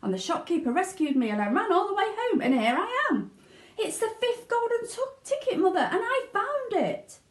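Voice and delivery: put-on high, eager child's voice tumbling out; a boy bursting with his big news; excited boy Charlie.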